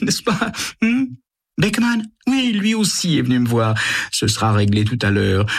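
high, mocking triumphant tone; scheming woman gloating in a high voice; gleeful scheming voice. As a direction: gloat to your lover on the phone. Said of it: "d'une voix que Sam a du mal à reconnaître".

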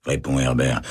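usual speaking voice

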